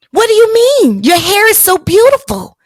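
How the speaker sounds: high, bewildered protest; puzzled stranger. Retold or imitated. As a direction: play her baffled surprise. Woman's puzzled reply voiced.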